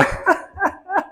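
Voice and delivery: high airy giggle before the punchline; light chuckle; high breathy laugh. Real, not acted. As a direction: laughs as the joke comes to mind. Starting the joke.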